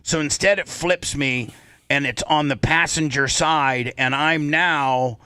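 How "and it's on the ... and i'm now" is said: ordinary talk, nothing marked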